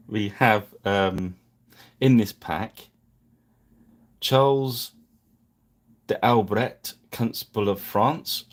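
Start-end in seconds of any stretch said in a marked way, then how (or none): 4.2-8.4 names said with a clumsy try at a French accent; "Charles de Albret" in a shaky French accent; attempted French. Shown as a demonstration. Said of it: "let's get the poor French pronunciations over with".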